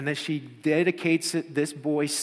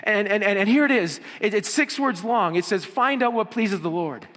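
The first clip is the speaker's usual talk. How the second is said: put-on eager girl; 6 semitones higher, 5 dB louder than usual. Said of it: "so she goes".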